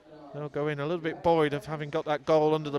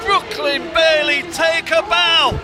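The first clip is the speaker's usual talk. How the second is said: far higher, much louder, slow roar; ecstatic shout; 10 semitones higher, 9 dB louder, slower than usual. Real, not acted. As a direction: scream it for a wonder goal. Long-range goal commentary.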